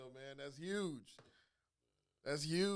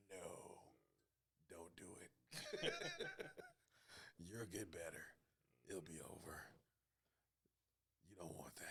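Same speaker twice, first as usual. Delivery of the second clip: soft, low gruff mutter; a hushed growl, lower and softer; gruff Sean Penn voice; 7 semitones lower, 12 dB quieter than usual. Retold or imitated. Imitating Sean Penn, lower, softer and gruff. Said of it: "he always talks with the gruffness".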